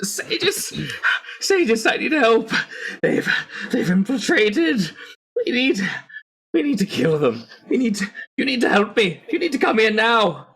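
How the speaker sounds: panicked shouting. Acted. Cornered villain shouting for help into his stone, panicked. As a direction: yell for help like the walls are closing in. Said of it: "he's shouting into it".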